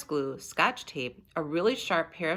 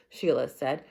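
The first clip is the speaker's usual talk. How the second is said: plain reading voice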